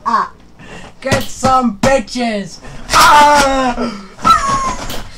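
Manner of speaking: real laughing screams. Real, not acted. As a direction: scream it while cracking up.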